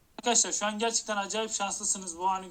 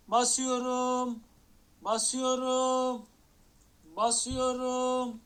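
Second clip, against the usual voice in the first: drawn-out suspense; 3 semitones higher, much slower than usual. Real, not acted. Teasing the case opening suspense.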